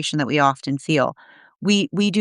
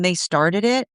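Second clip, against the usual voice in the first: a mild hint of a sulky blamer; understated imitation of a defensive person; defensive excuse. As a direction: lightly voice someone shifting blame. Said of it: "And someone going".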